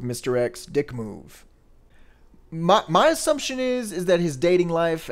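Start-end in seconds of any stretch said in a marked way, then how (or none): 0.6-1.4 "dick move" dropped quieter, falling; quiet repeat. Real, not acted. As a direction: mutter "dick move" softly.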